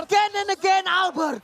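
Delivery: high, loud hype call; excited shout. Real, not acted. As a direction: caster yelling with excitement. Casters hype a big kill.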